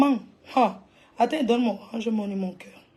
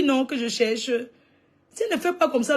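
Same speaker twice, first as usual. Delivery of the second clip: normal talking voice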